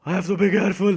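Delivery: exaggerated fretful voice; mock panic. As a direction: cry it out in put-on alarm. Hammed-up panic before serious restatement.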